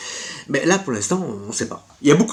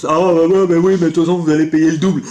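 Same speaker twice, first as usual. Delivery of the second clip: mock salesman; 3 dB louder than usual. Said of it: "je ne vais pas vous dire".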